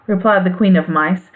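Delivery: plain reading voice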